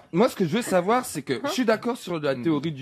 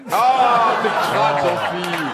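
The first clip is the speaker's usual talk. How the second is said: disgusted shout; slower than usual. Real, not acted. Disgusted cry at a gross remark.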